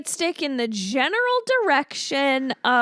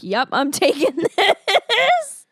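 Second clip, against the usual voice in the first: slow, louder, laughing through the leprechaun's words; 4 semitones higher, 4 dB louder, slower than usual. Retold or imitated. Leprechaun grabbing the orange toy car, slow and gleeful. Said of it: "he's like".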